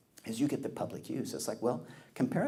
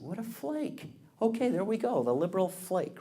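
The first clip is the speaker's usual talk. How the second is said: scornful jab; 8 semitones higher, 3 dB louder than usual. Real, not acted. Mocking absent congressman.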